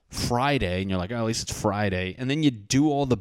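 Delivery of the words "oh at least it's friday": normal talking voice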